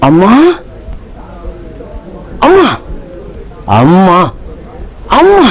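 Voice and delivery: lightly voiced young son calling out; son calling mother. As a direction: call for your mother gently. (அம்மாவை அழைக்கும் மகன் குரல்)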